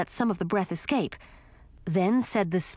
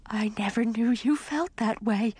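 hurt little whisper; 5 semitones higher than usual. Acted. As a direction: breathe it out, wounded. Hurt skull on the ground.

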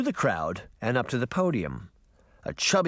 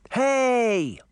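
high, loud indignant yelp; little girl calling out, offended; child's shout; 11 semitones higher, 5 dB louder than usual. Acted. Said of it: "Daphne shouted".

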